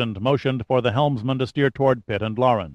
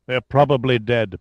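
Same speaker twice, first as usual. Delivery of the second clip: plain reading voice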